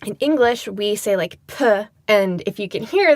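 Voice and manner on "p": "P," as a bare puh with a puff of air; aspirated P. Shown as a demonstration. Demonstrating English aspiration, "P," released as a breathy puh. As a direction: let breath follow the sound of "P,". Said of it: "there's a little bit of air behind it".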